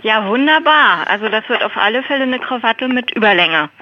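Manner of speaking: usual speaking voice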